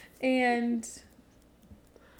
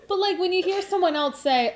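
louder, talking through laughter; laughing; 9 semitones higher, 8 dB louder than usual. Real, not acted. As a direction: keep laughing as you talk.

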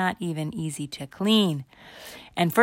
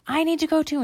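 put-on high-pitched kid plea; acted high, urgent whine; urgent little girl; 12 semitones higher, 4 dB louder, faster than usual. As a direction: insist in a high little girl's voice. Candy urgently needs toilet.

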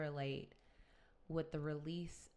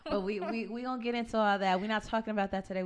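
fast and laughing; 4 semitones higher, 7 dB louder, faster than usual. Real, not acted. Laughing, rushing past topic.

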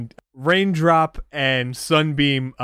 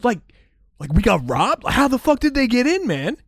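much higher, loud surfer-dude voice; stoner bros; 12 semitones higher, 5 dB louder, faster than usual. Acted. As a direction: freak out like a clueless stoner.

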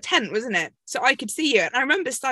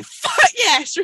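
put-on much higher, louder Scottish-accented yell; loud Scottish shout; 12 semitones higher, 7 dB louder than usual. Coach yelling from the audience, loud Scottish shout. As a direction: bellow it from the crowd in a Scottish accent. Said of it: "really loud in this big Scottish voice".

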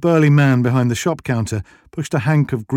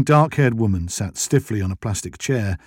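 ordinary delivery, nothing marked

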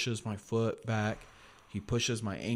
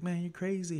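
sweet big guy; 10 semitones higher than usual. Retold or imitated. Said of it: "really sweet".

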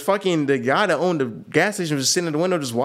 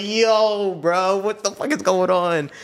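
higher, slow, appalled; can't believe what he's seeing; shocked disbelief; 7 semitones higher, much slower than usual. Retold or imitated. Driver seeing men leave the gas station bathroom, shocked.

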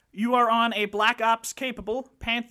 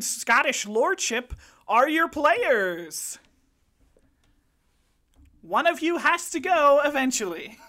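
loud, higher, hammed-up grand host; Lord Riley Walton; 4 semitones higher, 6 dB louder than usual. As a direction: boom out the welcome like a self-important lord.

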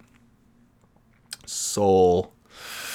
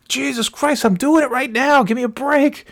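high squeaky exasperated voice; exasperated wife. Retold or imitated. Wife snapping at teasing husband, high mock exasperation. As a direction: squeal it out as if fed up.

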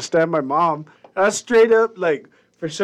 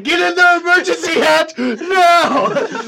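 much higher, louder mock panic cries; panicked shouting; 13 semitones higher, 6 dB louder, slower than usual. Child shouts emergency orders to mom, mock panic. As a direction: yell as if the house is under attack.

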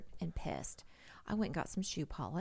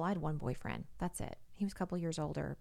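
ordinary talk

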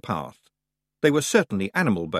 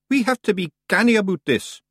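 high, loud broad Scottish pixie voice; a cocky wee Scots fighter; tiny Scots warrior; 9 semitones higher, 4 dB louder than usual. Acted. Rob Anybody answering the boy, high broad Scots.

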